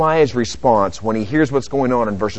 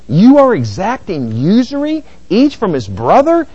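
raised, pointed rhetorical question; emphatic; 6 semitones higher, 4 dB louder than usual. Acted. Nehemiah confronting nobles over usury, emphatic. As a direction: put the charge to them squarely. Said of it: "it's emphatic".

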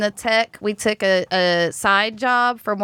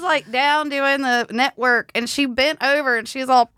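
usual speaking voice

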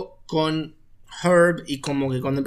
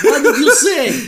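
much higher, louder, slow laughing What did you say; laughing mock menace; 11 semitones higher, 9 dB louder, slower than usual. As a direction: crack up while overplaying it. Laughing repeat of the line.

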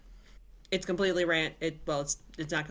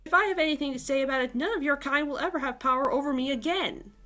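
defiant; 7 semitones higher, faster than usual. Acted. Sarah defies the villain.

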